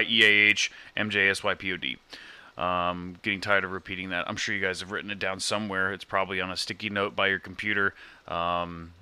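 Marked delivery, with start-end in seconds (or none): none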